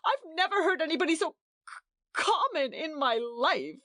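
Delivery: high, tearful rush that slows on common; a crying wife repeating how rude the hostess is. A narrator's reading. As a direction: rush then drag out the complaint through tears. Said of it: "exclaimed Edith brokenly".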